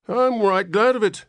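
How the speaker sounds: put-on very high loud old man's whine; whining old man. A narrator's reading. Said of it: "whined the old man".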